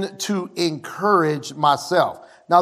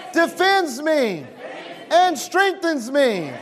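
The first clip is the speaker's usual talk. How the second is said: higher, shouted declarations, each line punched out; preaching at full force; 11 semitones higher than usual. Acted. Pastor shouts declarations for the church to repeat. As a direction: proclaim every line like a battle cry.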